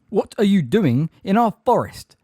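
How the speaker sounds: high, loud, territorial demand; angry bellow. A narrator's reading. Grey centaur challenging trespassers, angry bellow. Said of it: "bellowed the hard-faced grey centaur".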